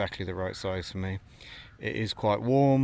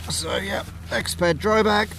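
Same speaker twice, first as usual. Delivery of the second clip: yelling over helicopter; 9 semitones higher, 8 dB louder than usual. Real, not acted. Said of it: "sorry about the helicopter noise".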